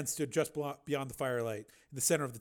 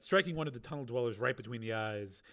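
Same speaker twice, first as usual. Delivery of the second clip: usual speaking voice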